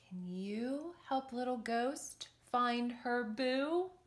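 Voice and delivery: ordinary delivery, nothing marked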